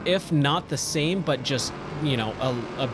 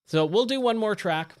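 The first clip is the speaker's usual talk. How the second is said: losing voice; 8 semitones higher, 3 dB louder than usual. Real, not acted. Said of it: "I feel like I'm losing my voice".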